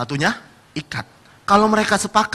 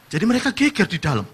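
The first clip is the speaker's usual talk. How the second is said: usual speaking voice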